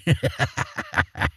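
high airy mischievous cackle; witch cackle. A narrator's reading. Witch gloating over the children, airy high cackle. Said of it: "laughed mischievously".

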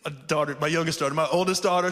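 ordinary delivery

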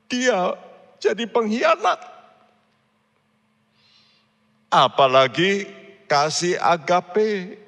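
high, loud preaching at full force; fiery preaching. Acted. Pastor preaching Peter's brotherly love to the congregation, high and loud.

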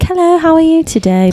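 chirpy cashier in a mock British accent. Cashier greeting a customer in light mock British. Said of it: "talk in accents to all the customers".

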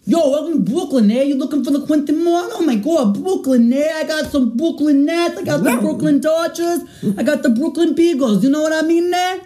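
very high, hammed-up Brooklyn voice; Brooklyn accent. Shown as a demonstration. Host demonstrating for the friend to mimic, exaggerated Brooklyn accent. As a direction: pitch it way up in a caricature Brooklyn accent. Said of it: "I'm gonna start with Brooklyn".

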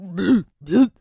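loud gagging bleh; mock gagging. Host acting out the taste, loud mock gag. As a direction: gag loudly in disgust.

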